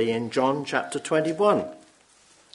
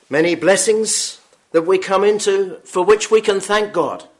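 louder, higher preaching that swells; building sermon; 6 semitones higher, 12 dB louder than usual. Acted. Preacher urging the congregation, louder preaching. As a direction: press the point home to the hall.

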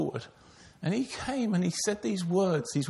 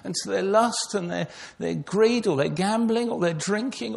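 louder, driving sermon voice; preaching; 3 semitones higher, 4 dB louder than usual. Acted. Preacher presses the congregation about captivity to sin. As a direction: build it like a pulpit call.

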